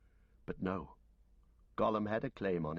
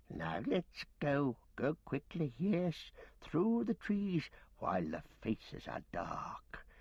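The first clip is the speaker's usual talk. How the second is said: Gollum voice; 7 semitones higher, slower than usual. Acted. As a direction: croon and snarl like a starved cave creature gloating over fish. Gollum fawning at Frodo.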